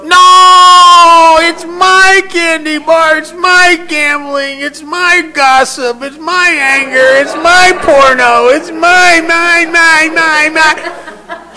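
very high, drawn-out screaming wail; hysterical child's tantrum. Acted. Girl refuses to let go for the paramedic, hysterical screaming tantrum. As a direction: scream possessively, stretching every MY. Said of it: "she's hysterical".

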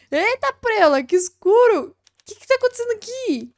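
high, loud laughing surprise in the dark; real startled laughter, shouting into the dark. Real, not acted.

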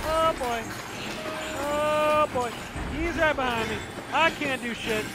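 high-pitched panic at normal volume; helpless panic. Real, not acted. Player with the monster right behind him, panicked. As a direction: squeak it out, frightened and helpless.

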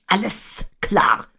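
high, slow owl voice; Eule. A narrator's reading. Owl agreeing to fly, high and slow. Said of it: "sagte Eule".

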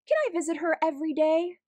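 put-on high, fast, eager child's plea. Child begging to visit Amber, eager and fast. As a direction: rush the question out, bursting with hope.

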